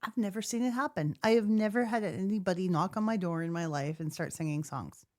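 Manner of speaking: ordinary talk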